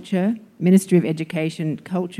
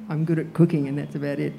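laughing; 2 semitones lower, faster than usual. Real, not acted. Self-deprecating joke.

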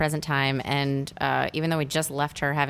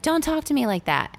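high-pitched mock yell; imitated screaming; 12 semitones higher than usual. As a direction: protest like the upset daughter, pitch jumped way up. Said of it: "She keeps screaming".

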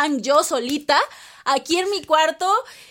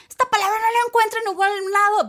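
puzzled complaint, pitched much higher; exasperated quote; 9 semitones higher than usual. Retold or imitated. Learner stuck on slang, complaining in a much higher, exasperated voice. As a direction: act baffled at a missing word. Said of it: "a veces lo dices".

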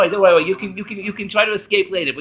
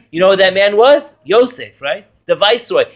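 ordinary talk, nothing marked

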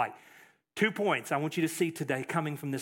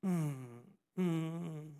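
mock moaning; 9 dB quieter than usual. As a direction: moan like a gloomy Pharisee. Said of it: "walk around moaning".

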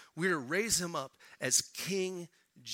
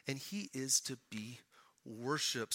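hushed whisper; 5 semitones lower, 9 dB quieter than usual. Acted. Sermon climax, dropped to whisper.

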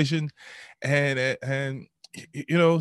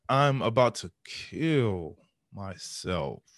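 very slow, low, drawn-out words; dragging, dawning realization; slowed-down answer; 4 semitones lower, slower than usual. Retold or imitated. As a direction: let each word sink in heavily. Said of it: "my answer slowed down".

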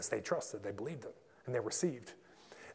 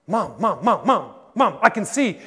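higher, louder cries of Mom; excited child; 7 semitones higher, 14 dB louder than usual. Acted. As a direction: call out to Mom in amazement. Boy calling his mother.

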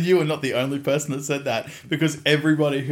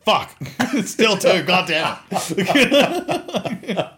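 high, loud cursing through laughter; mock-dismayed yell breaking into laughs; exasperated outburst; 10 semitones higher, 8 dB louder, much slower than usual. Dad-to-be after the recount, loudly exasperated. Reacting to the scan count.

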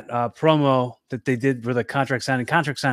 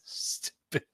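whispered; 17 dB quieter than usual. Real, not acted. Muttered aside.

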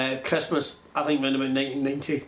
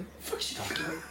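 much softer, whispered aside; puzzled whisper; 12 dB quieter, faster than usual. Retold or imitated. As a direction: murmur it under your breath, baffled. Puzzled aside about mum.

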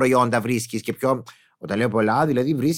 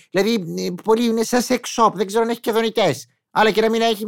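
normal talking voice

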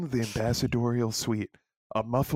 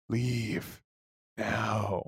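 cold alien command; 5 dB quieter than usual. Acted. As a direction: dismiss him like a distant alien. Alien ambassador orders him out.

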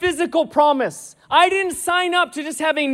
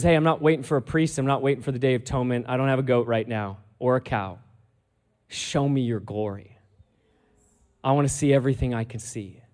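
lower, softer, earnest plea; low intimate plea; 12 semitones lower, 7 dB quieter, faster than usual. Retold or imitated. Moses asking God in a low, soft plea. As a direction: murmur it like a secret longing.

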